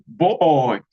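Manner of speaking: ordinary talk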